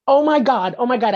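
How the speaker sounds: thrilled that the news proves it; excited. Retold or imitated. Recalling hearing the UFO news on the radio, excited.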